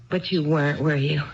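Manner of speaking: usual speaking voice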